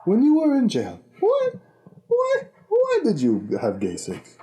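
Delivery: put-on African accent, rising. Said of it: "my African guy voice".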